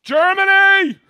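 high, loud, drawn-out cheer; excited shout. Real, not acted. Host hailing viewers in Germany, excited shout. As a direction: belt out the country name like a sports fan.

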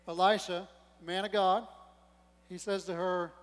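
usual speaking voice

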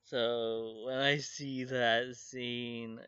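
usual speaking voice

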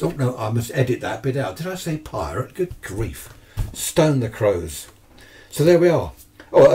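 normal talking voice

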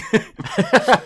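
high amused chuckling; laugh. Real, not acted. Baffled why they kept the clip, laughing. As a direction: break into a run of giggles.

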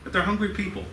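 "hungry" lifted high and leaned on; "hungry" pitched well up, the rest plain; stress on hungry. Shown as a demonstration. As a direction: punch the word "hungry". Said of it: "I like to emphasize".

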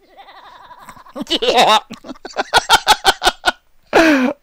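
high shrieking sped-up cackle; diabolical chipmunk laugh. Pretend. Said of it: "diabolical chipmunk".

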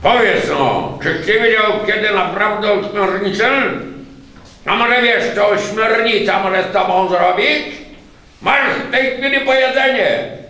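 high loud menacing monster; threatening octopus. A narrator's reading. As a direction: lean in with menace.

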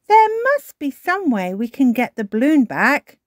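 rally everyone to help; urgent cry. A narrator's reading. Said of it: "cries Granny Pig".

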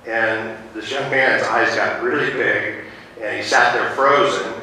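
normal talking voice

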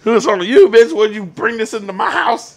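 loud, high, hammed-up outrage; over-the-top indignant hollering; mock accusing shout. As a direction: yell the blame in a pretend quarrel. Acted accusing partner in quarrel.

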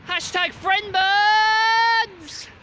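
slow stretched-out high shout; goofy shout. Pretend. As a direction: belt the catchphrase like a cheer. Silly catchphrase shouted for camera.

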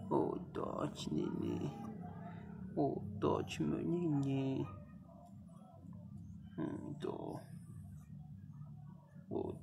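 slow soft pitying coo; tender cooing. Real, not acted. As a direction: soothe a suffering pet. Cooing pity at labouring dog.

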